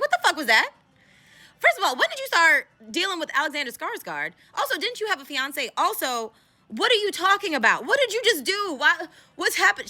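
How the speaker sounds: high-pitched, indignant interrogation; retold friend demanding answers, voice way up; incredulous questioning. Voices friend confronting Van.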